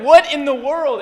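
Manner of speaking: loud, high-pitched disbelief; astonished outburst. Retold or imitated. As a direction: blurt it out, loud and incredulous. Retold shocked reaction to event.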